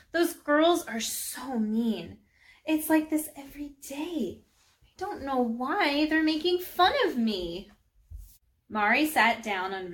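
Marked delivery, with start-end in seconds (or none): 0.1-7.7 put-on higher-pitched, aggrieved child's complaint; upset complaint. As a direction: pour out hurt at being teased. Girl complains of being bullied.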